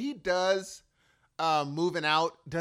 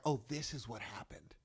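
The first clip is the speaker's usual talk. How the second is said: hushed, softer whispered realization; whispered to himself, choked up; tearful whisper; 4 semitones lower, 10 dB quieter than usual. Retold or imitated. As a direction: breathe it out, fighting back tears. Holding back tears at concert.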